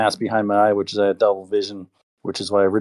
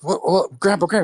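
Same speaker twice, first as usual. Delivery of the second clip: put-on much higher frantic kid voice; panicked kid; 10 semitones higher than usual. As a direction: call out in a high panic. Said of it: "it's like".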